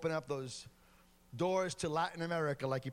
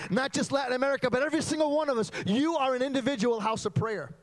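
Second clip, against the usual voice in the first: high, loud preacher's cry building in urgency; fiery revival preaching; preaching at full force; 5 semitones higher, 6 dB louder than usual. Acted. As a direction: thunder it to the whole congregation. Preaching climax to congregation.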